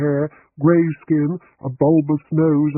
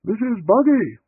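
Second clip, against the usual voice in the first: higher calling shout down the hall; 9 semitones higher than usual. A narrator's reading. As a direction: call her from the study doorway.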